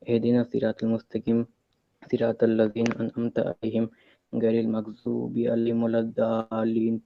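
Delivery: even, level-pitched Arabic recitation; Quran recitation. Shown as a demonstration. Caller reciting a surah at host's request, Quran recitation. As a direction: recite in measured phrases, drawing out the endings.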